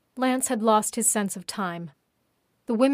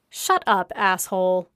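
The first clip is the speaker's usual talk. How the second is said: a spat, contemptuous retort, louder; 4 dB louder than usual. A narrator's reading. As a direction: spit the insult out in disgust. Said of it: "spat Carrie".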